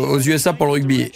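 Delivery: ordinary talk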